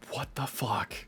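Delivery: breathy stunned whisper of disbelief. Real, not acted. Cohost stunned by the dog's death, whispered. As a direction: drop to a shocked whisper.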